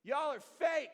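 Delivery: loud, slow taunting shout; loud taunt from the giant at the Israelites; giant yelling. Retold or imitated. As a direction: bellow it like a giant mocking the army. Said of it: "the giant is yelling".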